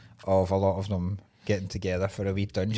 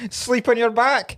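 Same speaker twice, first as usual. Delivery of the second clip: mock outrage; 11 semitones higher, 5 dB louder, slower than usual.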